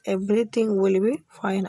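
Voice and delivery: very slow, each word spelled out; slow teaching demo. Shown as a demonstration. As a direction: say it slowly for learners to copy. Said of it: "आप अपनी friend को बोल सकते हैं". (धीमे-धीमे, साफ़ उच्चारण में)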